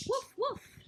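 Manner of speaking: high, loud yappy woof; high, loud puppy woofs; puppy bark. Acted. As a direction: bark it like an excited little puppy. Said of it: "said Biscuit".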